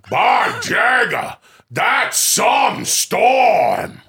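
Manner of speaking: high, drawn-out hammy shout; Al Pacino. Acted. Lion-O reacting to the meteor storm, loud hammy Pacino. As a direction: stretch each word into a big, slow holler. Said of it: "And Al Pacino as Lion-O".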